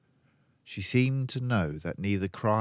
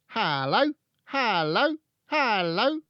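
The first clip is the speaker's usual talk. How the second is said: loud, high parrot voice, rising each time; high repeated parrot greeting, each lifting at the end; talking parrot; 7 dB louder than usual. A narrator's reading. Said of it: "It was exactly like a human voice".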